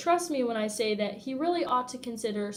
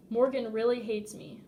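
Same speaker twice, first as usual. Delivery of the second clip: ordinary delivery, nothing marked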